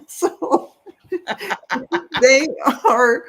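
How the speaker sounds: high, breathy laughter breaking through the words; laughing. Real, not acted. Reader laughing while giving Sally the message, high giggles. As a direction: talk through real giggles.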